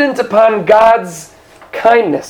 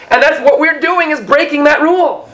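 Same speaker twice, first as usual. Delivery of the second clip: ordinary talk, nothing marked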